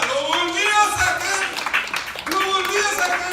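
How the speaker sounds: high, full-force preaching shout; grito de predicador. Real, not acted. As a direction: shout it out in triumph to the congregation. Predica a gritos la liberación repetida. (predicación a gritos, exaltada)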